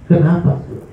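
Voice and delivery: ordinary talk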